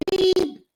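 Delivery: high mock bleep sound; censor beep. Host censoring her own swear word with a high beep. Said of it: "I almost said it".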